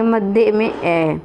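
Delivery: मध्य में ए drawn out very slowly to fix it in memory; teacher slowly repeats मध्य में ए so pupils remember; each word of मध्य में ए stretched, deliberate recap; slow emphatic repeat. Shown as a demonstration. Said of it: "ए आप मध्य में ए याद कर लेंगे".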